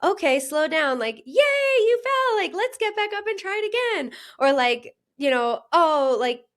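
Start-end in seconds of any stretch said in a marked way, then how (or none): 0.0-4.1 high, bright sing-song praise for a little one; much higher playful encouraging baby talk; cheering a toddler. Retold or imitated. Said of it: "You say". 5.7-6.4 put-on high, soothing praise for a toddler; high gentle good-job voice; encouraging a child. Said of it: "you're encouraging".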